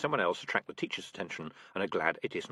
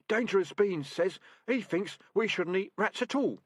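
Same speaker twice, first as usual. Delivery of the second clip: higher, thin worried report; anxious deputy rat; 10 semitones higher than usual. Acted. Deputy reporting to his sergeant, anxious. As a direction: report dutifully, a bit nervous. Inbrine quoting.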